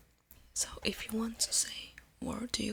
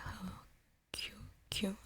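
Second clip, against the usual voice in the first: normal talking voice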